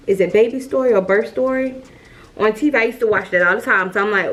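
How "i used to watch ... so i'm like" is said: ordinary delivery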